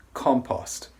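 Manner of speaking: compost ending on a crisp unvoiced T; compost demo. Shown as a demonstration. Teacher drilling compost's final T for learners. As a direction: say compost and release a clean T. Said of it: "The last sound is a T sound. It's unvoiced".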